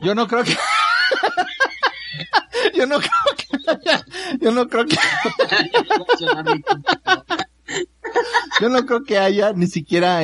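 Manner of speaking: giggling through the words; laughing. Real, not acted. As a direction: laugh while trying to talk. Laughing fit while repeating.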